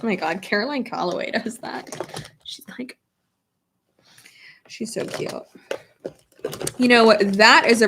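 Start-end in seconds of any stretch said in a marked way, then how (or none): 0.0-5.3 hushed, breathy aside; gossipy whisper. Real, not acted. Dishing to viewers about Caroline Calloway, whispered.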